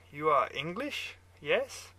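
put-on loud German-accented question, rising on "yes". A narrator's reading. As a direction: probe him in a German accent. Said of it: "your German accent".

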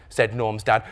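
usual speaking voice

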